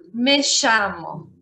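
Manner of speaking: "llamo" with a sh sound; Argentine sh. Shown as a demonstration. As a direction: say "llamo" as "shamo". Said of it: "Una frase con doble L".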